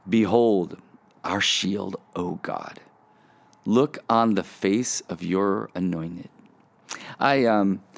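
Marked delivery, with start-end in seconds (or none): 0.1-6.3 slow, reverent reading with long pauses; scripture reading. Real, not acted. Reading a psalm to podcast listeners, slow and prayerful. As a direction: read it slowly, letting the voice settle down.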